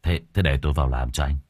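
low hesitant stammer; nervous stammer. Acted. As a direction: stammer like you're cowed. Feigned meek stammering.

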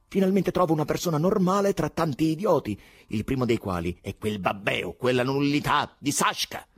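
loud, high-pitched indignant rant; heated contempt, loud and high; scornful outburst. A narrator's reading. Ivan insulting everyone around him.

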